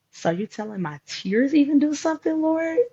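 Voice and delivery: high, loud disbelieving plea to the Lord; retold astonished cry upward; incredulous question. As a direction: ask God in amazed disbelief, pitch climbing high.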